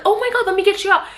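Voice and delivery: high, fast, mock-panicked rescue; frantic rescuer. Husband who should rush, mock-frantic and high. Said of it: "Like, not like".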